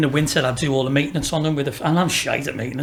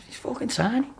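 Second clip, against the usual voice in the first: higher, softer, incredulous scoff; scoffing disbelief; 7 semitones higher, 5 dB quieter than usual. Retold or imitated. Scoffing at the cafe owners' boast, higher and softer incredulity. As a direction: sneer that the kid is far too small.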